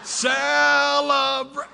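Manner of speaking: high, slow laughing shout of Celebrate; crowd-rousing yell; cheering shout. Real, not acted. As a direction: whoop it out to the crowd. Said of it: "drawn-out cheer to crowd".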